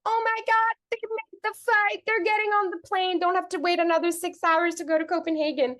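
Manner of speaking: high-pitched gushing relief; happy excitement. Retold or imitated. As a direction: squeal it, thrilled for them. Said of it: "I'm so happy".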